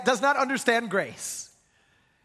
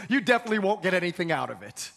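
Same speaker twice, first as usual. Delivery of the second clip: usual speaking voice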